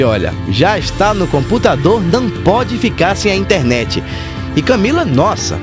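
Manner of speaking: ordinary talk, nothing marked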